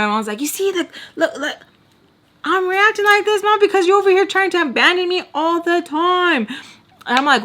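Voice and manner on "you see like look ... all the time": high, pleading outrage held throughout; mad protest. Retold or imitated. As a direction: accuse mom in a high, upset voice. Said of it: "I'm so mad".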